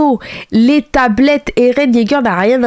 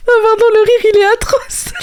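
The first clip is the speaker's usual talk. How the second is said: laughing; 12 semitones higher than usual. Real, not acted. Laughing over the clip's laugh.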